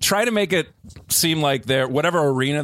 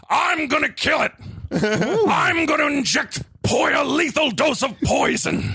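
shouting rant; 12 semitones higher than usual. Acted. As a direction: rant like a crazed heel. Shouted villain rant in character.

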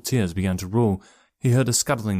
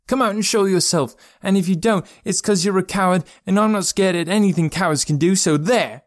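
high, raised voice, daring the intruder; defiant; 10 semitones higher, 4 dB louder than usual. A narrator's reading. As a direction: throw down a childish dare, pitched high. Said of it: "cried Joe defiantly".

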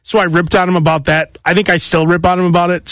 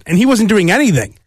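ordinary talk

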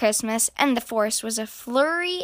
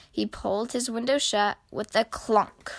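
ordinary delivery, nothing marked

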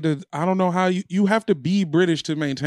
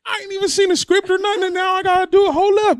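indignant; 12 semitones higher than usual. Real, not acted. High loud indignant protest.

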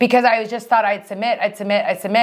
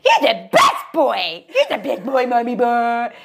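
slow cooing mock voice to a dog; mock baby talk; slower than usual. Husband mimics wife cooing at the dog, mock baby talk. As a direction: coo at the dog. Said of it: "A lot of baby talk".

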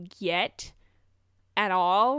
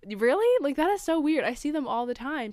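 surprised disbelief; 6 semitones higher than usual. Retold or imitated. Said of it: "And I was like".

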